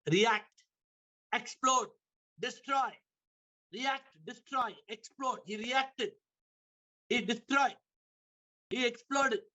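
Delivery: each word high and punched apart; hammered words. Real, not acted. Preacher hammering the lesson to the congregation, each word high and separate. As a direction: drive each word home. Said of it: "preacher hammering key words".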